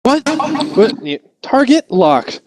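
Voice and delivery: startled then a slow confirm; huh, then lock on; confused gunner. Retold or imitated. Gunner hearing the call, confused.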